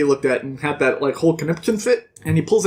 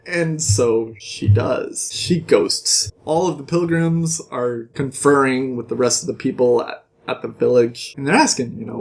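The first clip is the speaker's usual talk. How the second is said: usual speaking voice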